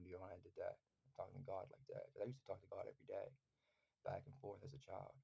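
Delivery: normal talking voice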